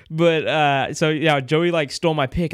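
ordinary talk, nothing marked

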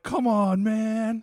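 high, whiny upset complaint; upset Joker. Retold or imitated. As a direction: whine it like a let-down Joker. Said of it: "he's, like, super upset".

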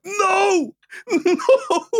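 high squeaky no broken by laughter; laughing no. Retold or imitated. Reliving his shock to his cohost, laughing high. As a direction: laugh through the denial.

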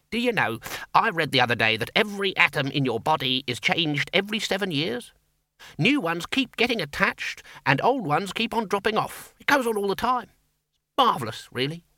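put-on high-pitched, wondering old academic; high donnish voice, full of wonder; musing senior wizard. A narrator's reading. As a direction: marvel aloud at a curious fact. Voices the Senior Wrangler in story.

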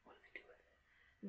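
hushed whisper. Real, not acted. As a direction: whisper it like a secret. Hushed question about the plot.

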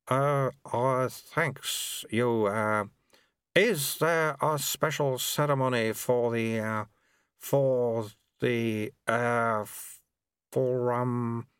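slow, halting flustered burble; a lost official fumbling for words; burbling. Acted. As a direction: stumble and lose the thread. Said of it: "the man burbled".

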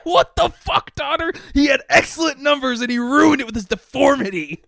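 hammed-up shouting Santa. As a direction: yell it like a furious boss. Santa berating Donner again.

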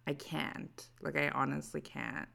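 usual speaking voice

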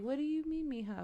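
an abuela gently confused; a hint of a puzzled grandma. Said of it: "she was like".

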